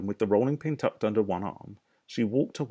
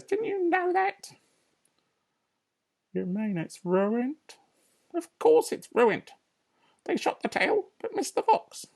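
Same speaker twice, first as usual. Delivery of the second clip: high, shrill-free bossy lady voice, much higher; Mrs Bean; 3 dB louder than usual. Acted. Farmer's wife calling down from upstairs, bossy and high.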